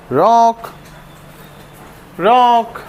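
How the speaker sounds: searching call with a lifted pitch. Real, not acted. Searching and calling the dog.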